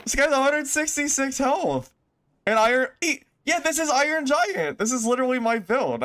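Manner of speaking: very high-pitched, astonished squeal of words; real excited outburst at a discovery; amazed excitement. Real, not acted. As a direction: pitch way up in sheer disbelief. Amazed at the opponent's gear.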